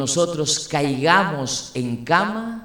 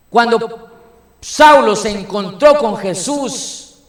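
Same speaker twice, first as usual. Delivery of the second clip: loud, raised sermon voice pressing each point; full-force preaching; 7 semitones higher, 7 dB louder than usual. Acted. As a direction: preach it out to the congregation at full power.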